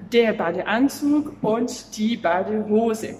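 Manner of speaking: "das Schwimmbecken" drawn out slowly and clearly; teacher modelling a German word slowly; slow vocabulary demo. Shown as a demonstration. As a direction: present the new word slowly to the class. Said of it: "Je vais t'apprendre du vocabulaire".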